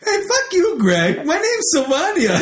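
high-pitched mock outrage, half laughing; indignant retort. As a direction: snap back in comic indignation. Acting out Sylvania's indignant retort.

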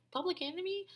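high, soft questioning lilt; acted uncertain recognition, high and soft; imagined tentative guess. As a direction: guess it lightly, pitch climbing at the end. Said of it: "could at least be like".

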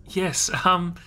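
high, hesitant nervous office worker; flustered Jacques. Acted. Jacques asks the train attendant for stationery, high and flustered. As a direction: stammer it out, up high and unsure.

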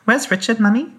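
put-on high little child's voice; child asking Mummy. Game master voices child asking mother.

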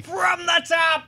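high, shouted from the top; shouting restart. Real, not acted. Cohost restarting the show, shouting. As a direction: call for a restart at full voice. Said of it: "Start over".

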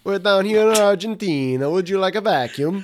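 high, loud put-on accent; mock Argentine accent. Friend joking in a mock Argentine accent. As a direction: ham up an Argentine host. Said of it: "What's their accent?".

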